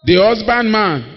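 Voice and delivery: slow, drawn-out Bible reading; solemn scripture reading. Acted. Preacher reading James to the congregation, slow and solemn. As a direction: intone the verse slowly.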